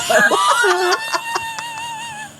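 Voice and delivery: high squealing laugh after a joke; burst of laughter. Real, not acted.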